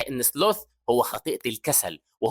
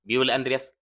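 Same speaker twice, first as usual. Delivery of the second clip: usual speaking voice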